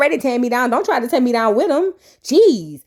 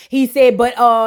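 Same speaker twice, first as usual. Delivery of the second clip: ordinary talk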